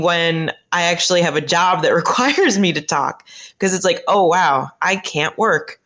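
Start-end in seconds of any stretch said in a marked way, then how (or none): none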